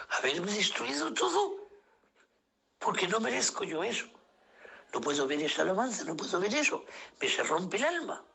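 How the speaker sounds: como a punto de llorar, con la voz alta y quebrada; voice breaking. Real, not acted. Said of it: "Salgo llorando". (voz quebrada por la emoción)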